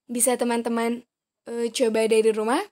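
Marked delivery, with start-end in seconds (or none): none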